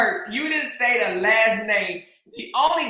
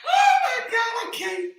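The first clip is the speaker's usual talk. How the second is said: helpless laughter; 12 semitones higher than usual. Real, not acted. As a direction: squeal it out, worn out from laughing. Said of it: "I am done".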